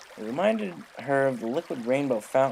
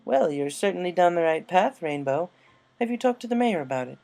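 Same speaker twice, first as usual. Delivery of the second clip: Rarity urging Rainbow to share her idea; 6 semitones higher, 7 dB louder than usual. Acted. Rarity voiced.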